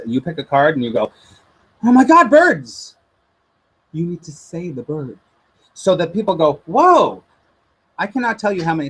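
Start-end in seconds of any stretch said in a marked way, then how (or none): 1.8-3.0 excited gasp. Retold or imitated. Reader blurts out a sudden intuition. 6.7-7.2 amazed whoa. Retold or imitated. Client reacts in amazement.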